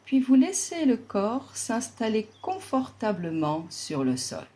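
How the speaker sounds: ordinary talk, nothing marked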